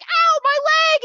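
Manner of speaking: loud, high, stretched cry of pain; pained yelp. Acted. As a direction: yelp as the leg gets crushed. Leg squished against fence.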